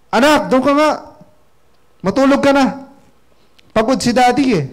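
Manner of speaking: angry father. Retold or imitated. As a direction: snap at the child like a tired, annoyed father. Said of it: "Nagalit ngayon si daddy niya".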